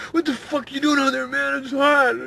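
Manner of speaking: very high, loud angry yell; pissed off. Retold or imitated. Dave bursting in at his friend, angry yell. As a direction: storm in and chew him out. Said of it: "He was so pissed".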